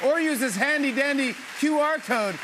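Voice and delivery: high, loud, shouted host wrap-up; show host outro. Real, not acted. Host urges audience to vote and stick around, shouted. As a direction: pump up the studio crowd.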